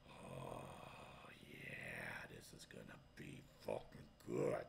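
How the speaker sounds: low, soft sinister whisper; gleeful whisper. Retold or imitated. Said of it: "I'm like".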